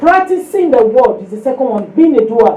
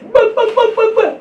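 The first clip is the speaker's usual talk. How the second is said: high babbling imitation of tongues; mock speaking in tongues, pitched up; mock tongues babble; 9 semitones higher than usual. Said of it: "They were speaking in tongues".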